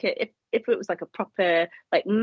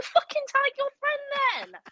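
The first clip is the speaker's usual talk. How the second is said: exasperated yell; slower than usual. Retold or imitated. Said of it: "and I was like".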